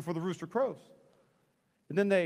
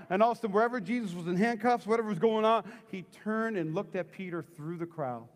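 ordinary delivery

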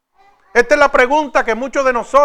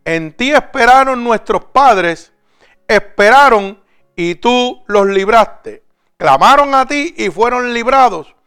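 solemn scripture reading; 4 semitones lower, 3 dB louder than usual. Acted. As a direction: read it like a lament before the congregation. Reads Psalm 22 over music.

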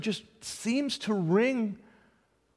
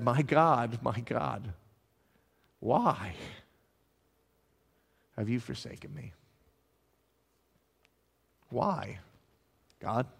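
low, soft, with long pauses; forsaken lament; 7 semitones lower, 6 dB quieter than usual. Acted. Preacher voicing Jesus crying to God, hushed lament. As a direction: let each phrase sink into silence.

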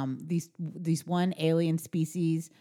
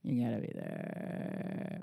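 soft, slow whispered echo; 7 semitones lower, 9 dB quieter, much slower than usual. Real, not acted. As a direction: whisper it back sadly.

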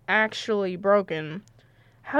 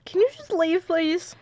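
higher, strained plea to be left alone; upset plea; 6 semitones higher than usual. Acted. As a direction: beg her to go, voice tight. Heartbroken, sends Clary away.

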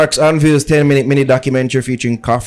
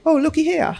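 high, put-on English accent; a bad fake English accent. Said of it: "That was horrible".

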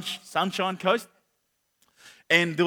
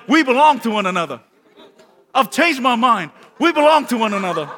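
louder, meaning every word; heartfelt declaration; 4 semitones higher, 7 dB louder than usual. Shown as a demonstration. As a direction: say it like you truly mean it. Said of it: "turn to them like".

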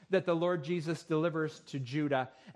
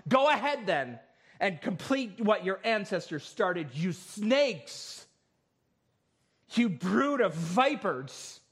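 higher-pitched, driving pulpit proclamation; forceful scripture reading; 4 semitones higher than usual. Acted. Preacher reads Jesus' woes to the Pharisees, forceful scripture reading. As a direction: declaim it as a stern denunciation.

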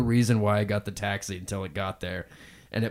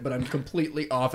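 usual speaking voice

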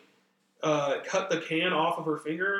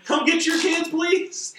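high, louder retold plea; exasperated plea; 10 semitones higher, 5 dB louder, slower than usual. Bystander silently begging the parent to fetch the kids, exasperated plea. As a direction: plead in a raised, high-pitched voice.

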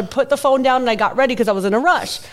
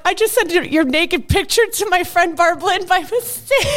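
mock panicked confession; 6 semitones higher than usual. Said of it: "and then I'm like".

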